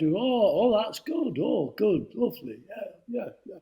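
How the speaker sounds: a hint of Hockney pleased, offhand; David Hockney impression. Hockney hears the painting has arrived, light breezy impression. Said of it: "that's a great impersonation".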